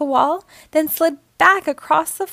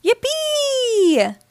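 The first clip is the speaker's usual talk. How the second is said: high, long held cheer; gleeful cry; 12 semitones higher than usual. A narrator's reading. Dad suddenly having fun sliding, gleeful cry. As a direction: whoop it like a kid having fun. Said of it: "cried Dad, suddenly having fun".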